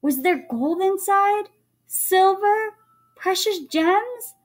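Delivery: high, eager questions; excited wondering. A narrator's reading. Jack wonders about the treasure.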